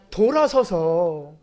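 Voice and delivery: 돌아서서 stretched very slow, each syllable held; slow right down on 돌아서서; drawn-out emphasis. Real, not acted. Preacher showing the congregation how David turned away, very slow.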